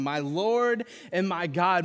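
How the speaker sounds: loud, slow cry of faith; yelling. Retold or imitated. Thomas crying out to Jesus, loud and slow. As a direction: call it out as if overwhelmed. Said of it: "he's yelling this".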